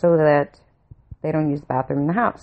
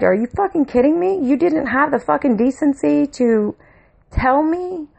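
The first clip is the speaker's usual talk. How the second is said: furious screaming; 8 semitones higher than usual. Retold or imitated. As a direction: scream it at him, fed up and betrayed. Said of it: "I'm screaming at my dad".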